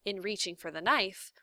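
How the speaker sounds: plain reading voice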